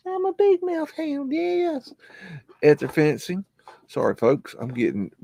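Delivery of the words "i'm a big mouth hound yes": very high put-on dog voice; dog's voice. Voicing the hound answering its owner, very high.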